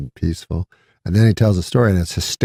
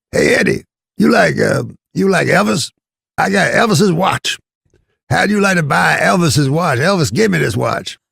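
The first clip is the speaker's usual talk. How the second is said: raised, raspy hustler pitching a watch; an old comic working a sales pitch; Red Fox voice; 10 semitones higher, 4 dB louder than usual. Retold or imitated. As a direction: sell it like a smooth-talking old comic. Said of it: "Red Fox would say".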